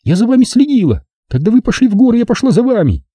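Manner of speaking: put-on high-pitched girl character, insistent; defiant girl. A narrator's reading. Zaza tells how she followed them, defiant.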